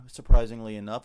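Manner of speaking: usual speaking voice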